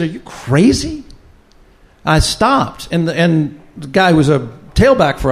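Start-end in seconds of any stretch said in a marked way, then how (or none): 0.0-1.0 higher-pitched, mock-incredulous question; retold disbelief, pitch jumping up on the question; incredulous retort. Retold sideline argument with the coach.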